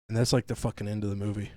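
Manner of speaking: ordinary delivery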